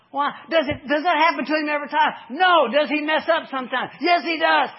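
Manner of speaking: high, loud call-and-answer preaching; preaching at full force. Acted. Preacher firing questions at the church, loud and rising. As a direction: drive it home to the congregation.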